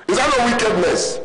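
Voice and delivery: high outraged question; indignant preacher voice. Real, not acted. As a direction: rise high in indignant disbelief. Outrage at the car gift.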